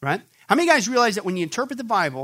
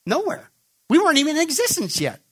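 emphatic outburst; 9 semitones higher than usual. Acted. Answering his own question emphatically.

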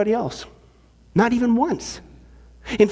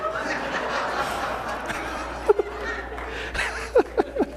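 laugh; 9 dB quieter than usual. Real, not acted. Laughs at own joke.

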